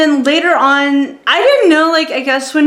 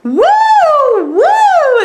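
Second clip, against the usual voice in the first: high-pitched "woo" of an excited crowd; mock audience whooping; imitated fangirl cheer; 5 dB louder than usual. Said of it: "women go like this".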